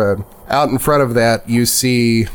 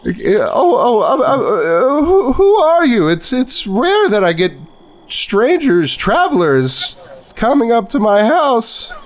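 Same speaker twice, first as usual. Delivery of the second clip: put-on flustered old lady; 10 semitones higher, slower than usual. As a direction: fumble the words like a startled granny.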